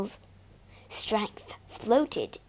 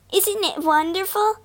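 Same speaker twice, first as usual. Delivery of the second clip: laughing; 9 semitones higher, 3 dB louder than usual. Acted. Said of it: "laughed Clara".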